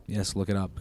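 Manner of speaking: ordinary talk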